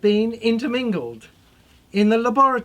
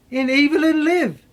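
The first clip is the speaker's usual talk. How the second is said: usual speaking voice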